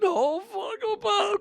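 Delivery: put-on high, frantic kid's plea to his dad; boy begging his dad to stop; panicked pleading. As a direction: beg like a terrified little kid.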